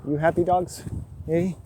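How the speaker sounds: high, loud sing-song greeting to dogs; calling to dogs. Real, not acted. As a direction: call out brightly to the puppies.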